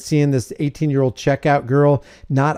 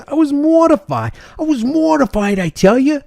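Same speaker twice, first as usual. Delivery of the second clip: high, exaggerated New York accent; 11 semitones higher than usual. A shocked shopper quoted in a hammed-up New York accent. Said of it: "You gotta see it in a New York accent".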